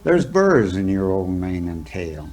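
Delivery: plain reading voice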